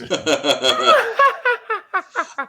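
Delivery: high, loud villain cackle; evil voice. Acted. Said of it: "hear my evil voice?".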